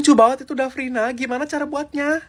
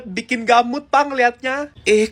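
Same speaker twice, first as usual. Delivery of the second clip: usual speaking voice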